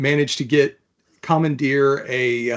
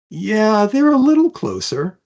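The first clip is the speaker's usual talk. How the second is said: high, light and a little louder; high voiced reply; 8 semitones higher, 4 dB louder than usual. Creature on the fleeing boat remarks on the pursuers, high and amused. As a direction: answer as the grinning creature, amused.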